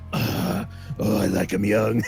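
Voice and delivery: low creepy voice; mock pervert. Friend retelling what customers heard on the clock, low creepy pervert voice. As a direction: imitate the creep, pitched low. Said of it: "like, some pervert".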